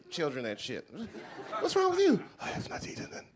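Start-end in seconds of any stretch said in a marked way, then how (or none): 1.6-2.2 mock do-gooder. Woman asking starving child. 2.4-3.3 put-on weak starving child.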